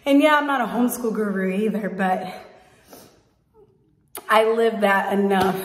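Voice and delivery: panting between words after a workout; breathless, still catching breath. Real, not acted. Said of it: "I'm out of breath".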